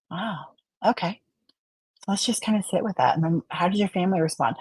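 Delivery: a quiet, caring counsellor's tone; soft therapist voice. Shown as a demonstration. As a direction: hold space for a hurting client. Therapist voice sitting with a client.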